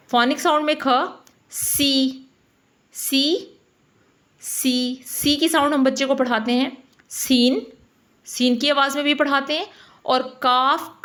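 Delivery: ordinary talk, nothing marked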